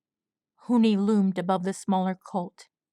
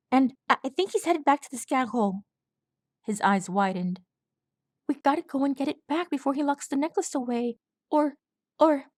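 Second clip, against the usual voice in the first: high, breathless frightened colt voice; panicked young colt; 6 semitones higher than usual. A narrator's reading. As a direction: blurt it out like a terrified little colt. Swab urging.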